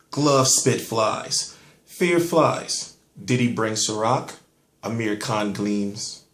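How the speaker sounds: slow, measured poem reading; deadpan spoken-word poem delivery; poem recital. Shown as a demonstration. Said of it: "I wrote a poem".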